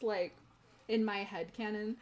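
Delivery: ordinary talk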